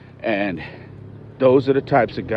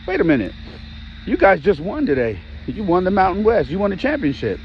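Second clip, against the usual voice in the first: retold excitement, higher throughout; eager rush of recognition, pitched up; excited; 9 semitones higher than usual.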